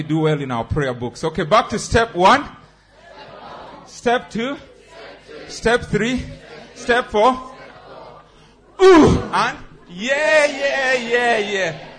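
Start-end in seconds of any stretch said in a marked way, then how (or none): 1.2-7.3 loud count. Shown as a demonstration. Instructor shouts steps. 8.8-11.9 high loud shout. Shown as a demonstration. Exercise release shout.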